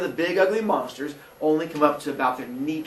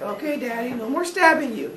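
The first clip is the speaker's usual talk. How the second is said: put-on higher, sheepish childish promise; meek kids; 8 semitones higher than usual. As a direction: answer like scolded children. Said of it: "They're like".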